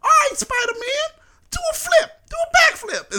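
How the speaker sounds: very high mock New Yorker shout; high-voiced impression. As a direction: egg the hero on in a squeaky yell. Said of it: "his voice is high".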